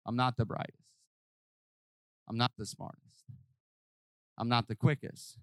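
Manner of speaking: low, soft, deflated inner voice; voicing self-doubting people. Preacher voicing a discouraged listener, deflated and soft. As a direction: mutter it like someone who feels small. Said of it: "people are left to sit there thinking".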